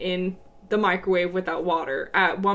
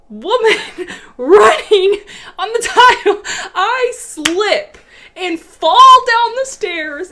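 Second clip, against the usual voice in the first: laughing; 10 semitones higher, 11 dB louder, slower than usual. Real, not acted. Laughing through retold fall.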